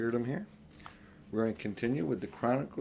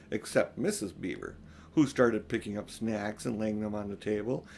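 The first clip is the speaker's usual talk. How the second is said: ordinary delivery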